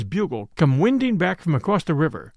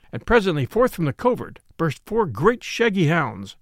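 plain reading voice

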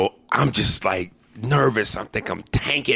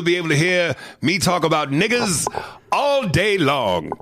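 1940s radio voice; 8 semitones higher than usual. Acted.

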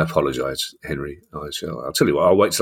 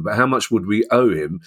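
normal talking voice

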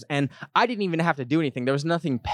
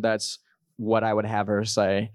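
ordinary talk, nothing marked

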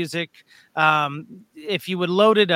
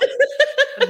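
burst of laughter. Real, not acted. Laughing at the clap song.

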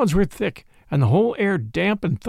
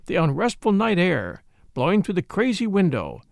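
ordinary delivery, nothing marked

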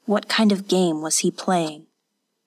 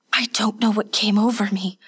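crying; 2 semitones higher than usual. Acted. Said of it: "Hatsumi cried".

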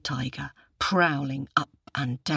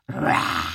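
low, long mock roar; tiger roar; 10 semitones lower, 4 dB louder than usual. A narrator's reading. Tiger opens wide over the boy, low roar. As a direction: let out a drawn-out roar. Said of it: "and roared".

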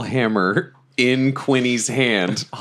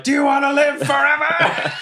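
high battle cry; rallying shout; 14 semitones higher than usual. Acted. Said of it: "I turn to them and I'm like".

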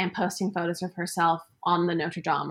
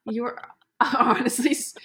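laughing; 3 semitones higher than usual. Real, not acted. Laughing at cohost's question.

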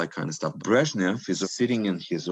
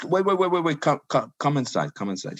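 eager call; 6 semitones higher, faster than usual. Retold or imitated. Official eagerly calls Brezhnev in.

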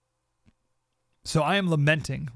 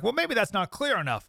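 higher-pitched imagined objector; mock quibble in a raised voice; doubting listener; 8 semitones higher than usual.

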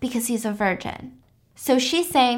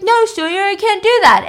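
high, louder mock protest; acted panicked refusal; 9 semitones higher, 6 dB louder than usual. Horrified refusal in retold scene.

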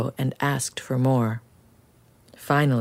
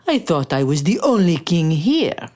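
louder, grand royal voice; pompous king voice; 3 semitones higher, 4 dB louder than usual. Acted. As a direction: speak like a haughty king. The king speaking.

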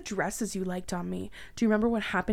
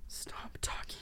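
breathless hushed plea; horrified whisper; 12 dB quieter, slower than usual. Acted. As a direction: beg him in a whisper.

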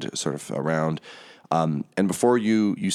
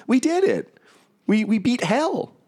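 much higher, pleading and bewildered; little Hollyphant clinging to her happy dream; Lulu, confused. Acted. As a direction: plead in disbelief, way up high. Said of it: "Lulu turns and kind of says".

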